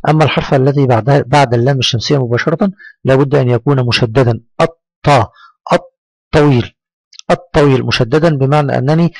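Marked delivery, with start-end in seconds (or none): 4.6-7.8 "الطا" and "الطويل" with a held, doubled t; pressed doubled consonant. Shown as a demonstration. Teacher demonstrates the shadda, held t in "الطويل". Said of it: "أقف عليه في النطق وأضغط عليه".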